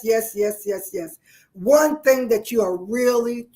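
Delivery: usual speaking voice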